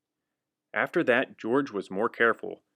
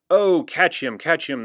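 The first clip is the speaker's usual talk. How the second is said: loud, higher urgent call; crying out; 5 semitones higher, 7 dB louder than usual. Acted. Firemen crying out to catch George. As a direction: urge them to grab him. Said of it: "they cried".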